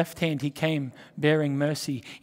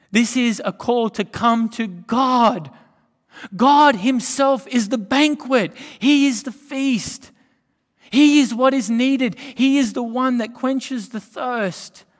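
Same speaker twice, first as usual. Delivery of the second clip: higher, louder, slowed preaching on God as the feast; full-force preaching; 7 semitones higher, 8 dB louder, slower than usual. Acted. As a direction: proclaim it to the whole hall, slow and big.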